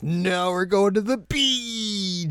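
high, slow singsong; singsong reply. Retold or imitated. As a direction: drag it out teasingly. Friends' singsong reply in recap.

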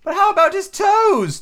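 very high, loud, drawn-out question; persistent. A narrator's reading. Mock Turtle pressing Alice, high insistent voice. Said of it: "the Mock Turtle persisted".

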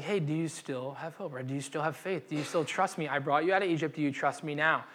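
gentle God voice. Retold or imitated. God gently asking Gideon if he still trusts, soft. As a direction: ask quietly, like reassuring a friend.

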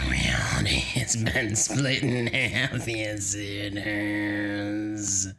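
slow, hushed demon whisper. Acted. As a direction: whisper it eerily, drawing out the last words. Demon voice cackling over recording.

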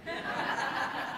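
a quiet airy chuckle; soft breathy laugh. Real, not acted. Preacher laughing at his own pizza story, quiet chuckle. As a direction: laugh under your breath.

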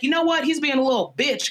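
mock a fed-up mother; exasperated mom. Mom egging her daughter on against her brother, exasperated. Mom riled in a retold quote.

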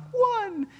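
high-pitched mocking toddler voice; mimicked toddler. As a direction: squeak it high, imitating a defiant little boy. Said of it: "You think you're in charge going".

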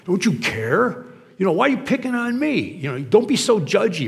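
high-pitched, hammed-up complaint; mock defensive whine. Criticized person objecting to being judged, mock defensive whine. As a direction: protest as if picked on. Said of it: "our reflexive action when somebody criticizes us".

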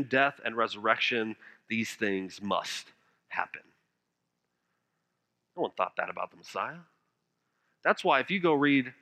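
low, soft preaching voice; quiet and measured, with weighty pauses; hushed solemn preaching. Real, not acted. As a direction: let the sermon sink to a grave hush. Preacher drops into hushed solemn passage.

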